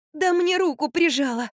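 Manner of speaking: high complaint through huffing. A narrator's reading. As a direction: huff crossly about being stuck. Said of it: "засопела Катя". (пыхтя, высоким голосом)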